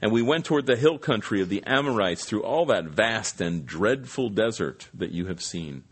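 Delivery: formal Bible reading voice; scripture reading. Acted. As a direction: read the passage with weight, evenly. Reads scripture passage aloud.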